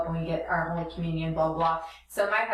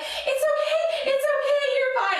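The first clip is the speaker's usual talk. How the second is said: very high, slow mock soothing; hammed-up reassuring coo; soothing volunteer. Volunteer calming the vomiting mother, high slow mock soothing. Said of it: "the volunteer's like".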